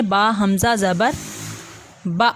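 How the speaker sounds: با spelt out slowly, then با with a catch in the throat; glottal jolt. Shown as a demonstration. Teacher spells a hamza word slowly: sharp glottal stop. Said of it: "جھٹکا ہم نے کس طرح سے دینا ہے".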